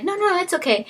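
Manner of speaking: squeaky high, brushing it off again; light high voice, waving it away; high polite refusal. Retold or imitated. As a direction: falsetto polite decline. Secretary declining a second time.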